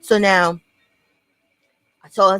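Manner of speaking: ordinary talk, nothing marked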